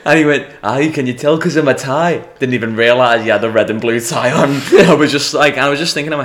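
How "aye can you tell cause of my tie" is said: higher, louder Scottish reply; Scottish accent. Retold or imitated. Scottish customer answering about his tie, Scottish accent. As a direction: imitate a proud Scot, raised voice. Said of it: "these Scottish fellas came up".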